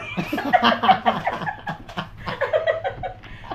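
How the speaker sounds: long, breathy cackling laugh; real burst of laughter. Real, not acted. After learning it was a joke, real burst of laughter. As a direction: burst out laughing and let it run.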